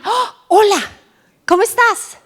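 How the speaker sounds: excited high-pitched hola, loud and eager; bright high greeting. Retold or imitated. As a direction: call out a cheerful hello in Spanish.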